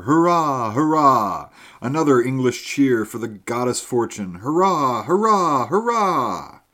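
put-on loud, high hurrahs from the old soldier; cheering. A narrator's reading. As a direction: shout the hurrahs out as a drinking cheer. Soldier cheers his English friend.

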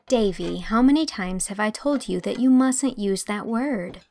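ordinary delivery

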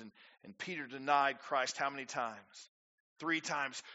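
normal talking voice

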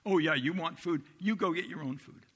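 exasperated parent. Retold or imitated. Jesus imagined as a fed-up parent, sarcastic. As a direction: snap it back like a fed-up parent. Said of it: "something an exasperated parent would say".

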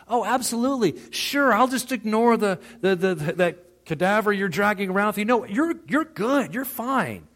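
high, loud mock agreement, dripping sarcasm; sarcastic. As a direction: play it as sarcasm to mock fake comfort. Sarcastic reply to easy fellowship.

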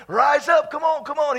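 rally him to his feet; urging encouragement. Acted. Preacher voicing the crowd calling the blind man, urging.

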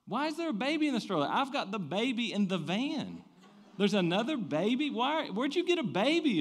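high, loud, incredulous questioning; retold yelp of disbelief; baffled alarm. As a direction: act out bewildered panic at a mystery. Acted baffled reaction to stranger's stroller.

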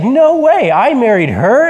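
high, loud, giddy disbelief; a loopy man thrilled by his luck; amazed. Retold or imitated. Groggy surgery patient amazed to learn he married her. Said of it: "he's so amazed".

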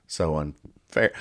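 low, slow, quiet sulk; deflated grumble. Acted. Penguin left out of passing, glumly grumbling. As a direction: let it sag, slow and soft.

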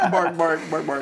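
eager barking dog. Pretend. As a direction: bark like an excited pup. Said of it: "[name]'s impression of a bitch".

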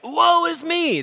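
high, loud anguished cry of woe; dramatic wail. Retold or imitated. Preacher mimicking Isaiah crying out, high loud wail. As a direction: wail it out like a man undone before God. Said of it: "he's like".

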